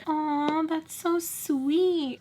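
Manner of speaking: high, drawn-out syrupy coo; mock gushing. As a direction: imitate a fawning friend, stretched and high-pitched. Imitating friend gushing over her remark.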